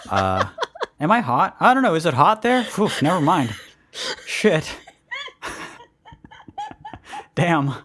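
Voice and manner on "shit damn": ordinary talk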